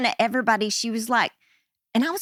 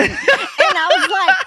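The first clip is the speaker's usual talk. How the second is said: laughing; 7 dB louder, slower than usual. Real, not acted. Laughing while retelling.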